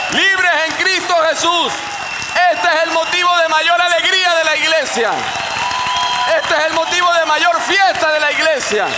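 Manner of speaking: alto y fuerte, gritando con júbilo; predicación a todo pulmón. Real, not acted. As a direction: proclama la libertad con fervor creciente. Preaching at full force. (predicador gritando con euforia)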